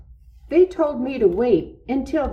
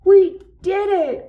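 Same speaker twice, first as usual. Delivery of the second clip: much higher, louder whoop of joy; excited cry; 11 semitones higher, 8 dB louder than usual. A narrator's reading. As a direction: burst out in triumph. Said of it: "cried Toad".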